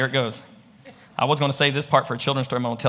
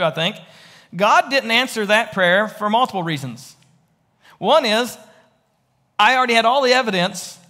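louder and higher, fervent pulpit voice; full-force preaching; 9 semitones higher, 4 dB louder than usual. Acted. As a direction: drive the point home to the congregation.

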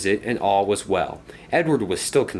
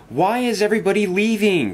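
high, loud, upset cry; dismayed outcry in a raised voice; 11 semitones higher, 5 dB louder than usual. A narrator's reading. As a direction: call it out in dismay, pitched high. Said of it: "cried Henry one morning".

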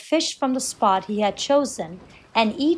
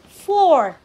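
high, loud mild shriek; 6 semitones higher, 6 dB louder than usual. A narrator's reading. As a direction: shriek the count as a narrator would, falling. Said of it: "shrieked the fourth brother".

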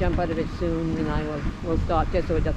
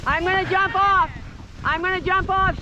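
calling out; 11 semitones higher than usual. Real, not acted. Calling out on sled.

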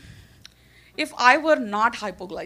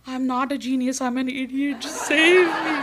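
panicked plea; 3 semitones higher than usual. Retold or imitated. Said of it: "there is this moment where you are like".

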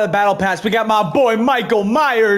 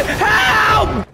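yell for help; 11 semitones higher than usual. Real, not acted. Being attacked.

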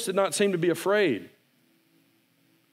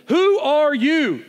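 high, loud and slow; awed loud question; 11 semitones higher, 8 dB louder, much slower than usual. Acted. Boy calling out to the unseen voice, loud and slow. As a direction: call out in wonder.

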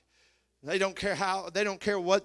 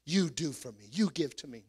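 put-on lower whiny demanding child; 4 semitones lower than usual. Mocking adult children demanding.

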